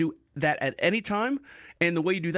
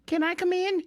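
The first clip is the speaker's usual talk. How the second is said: voicing the nurse; 12 semitones higher than usual. Nurse voiced asking to enter.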